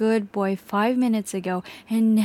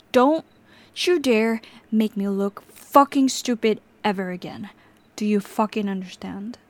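loud, biting warning on every word; angry threat; 3 dB louder than usual. Acted. Captor warning the tied-up victim, angry and loud. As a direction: snarl the warning at him.

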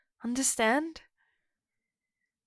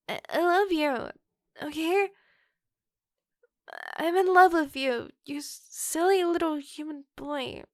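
high, loud, overwhelmed love confession; wolf girl blurting out her love to the human boy; shouted confession; 4 semitones higher, 8 dB louder than usual. Acted. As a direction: burst out with it all at once.

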